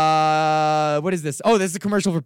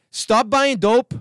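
slow, louder, higher scolding; mock stern warning; 8 semitones higher, 4 dB louder than usual. Real, not acted. Scolding friend Alex on the podcast, slow and stern. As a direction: drag it out like a stern parent.

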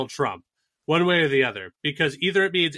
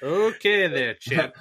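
lightly voiced condescension, a bit higher; mock patronizing voice; 3 semitones higher, much slower than usual. Talking down to Trump in a mock voice. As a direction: pat him on the head with the words. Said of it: "it's just like".

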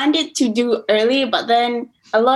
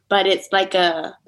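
usual speaking voice

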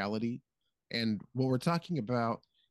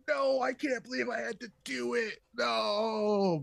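much higher, hammed-up despair; mock anguished Obi-Wan; 10 semitones higher, slower than usual. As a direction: wail it over the fallen apprentice. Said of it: "And then he's like".

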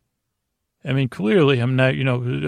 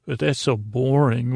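ordinary talk

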